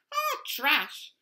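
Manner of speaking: high, loud bird squawk; squawking flamingo. A narrator's reading. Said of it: "squawked Flamingo".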